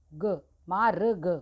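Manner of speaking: मार्ग said slowly with ग separated first; slow demo. Shown as a demonstration. Teacher slowly sounds out मार्ग for children.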